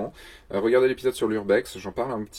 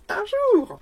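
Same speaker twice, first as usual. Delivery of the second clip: « Un jour » lancé très haut, en voix de tête; voix très aiguë; 5 dB louder than usual. Acted. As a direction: montez tout en haut pour « Un jour ». Trois prises de la même phrase. (voix de fausset)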